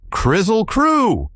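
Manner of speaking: high, drawn-out call of the magic words. Acted. Scraps calling the words at the Woozy, high and drawn out. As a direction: sing it out slowly and high. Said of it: "Scraps called out".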